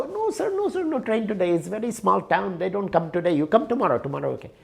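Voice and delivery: put-on the clerk calmly turning him away in an Indian accent. Indian clerk refuses again.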